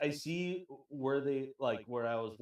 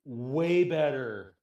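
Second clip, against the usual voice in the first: "way" drawn right out and louder, then "better"; emphatic WAY; 6 dB louder, much slower than usual. Real, not acted. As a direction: stretch "way" long and slow for emphasis. Insists on the comparison.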